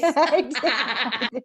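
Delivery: laughing. Real, not acted. Laughing agreement.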